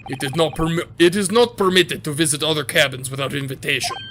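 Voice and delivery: put-on gruff Russian-accented guard, proud; guard showing off the first-class cabins, Russian accent. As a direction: boast like a self-important Russian guard. Sailor voice.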